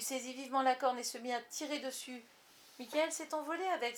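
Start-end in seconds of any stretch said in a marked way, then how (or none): 2.8-4.0 higher, louder cry of discovery; exclaiming. A narrator's reading. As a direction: burst out with sudden hope. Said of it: "s'écria-t-il". (s'écrier, plus aigu et plus fort)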